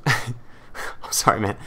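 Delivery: whispered giggle through sorry; can't stop chuckling while apologizing; apologizing mid-giggle to the fan; laughing apology. Real, not acted. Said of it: "I'm not laughing at you".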